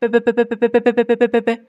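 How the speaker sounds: rapid buzzing "b-b-b-b" through the lips; lip trill. Shown as a demonstration. Teacher demonstrating the lip vibration exercise, rapid lip trill. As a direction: let air flutter the closed lips in an even, rapid buzz.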